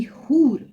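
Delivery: हूर with a full open oo; teacher reading हूर with an open oo; waw maroof demo. Shown as a demonstration. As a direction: give हूर a long clear oo. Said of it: "वाव मारूफ वो वाव है जो".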